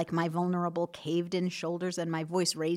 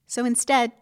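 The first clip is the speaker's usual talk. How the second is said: usual speaking voice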